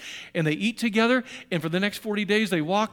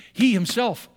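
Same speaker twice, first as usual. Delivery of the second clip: He himself punched, louder and higher; stress on He himself; 3 semitones higher, 6 dB louder, slower than usual. Shown as a demonstration. Preacher hammering He himself home to the church. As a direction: hammer both words He himself. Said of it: "there's a double emphasis".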